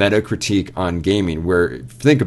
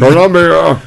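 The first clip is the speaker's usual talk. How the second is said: loud, high-pitched playful shout; mock cry; 10 semitones higher, 11 dB louder, slower than usual. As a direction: belt it out high in mock grandeur. Mock exclamation of game's city name.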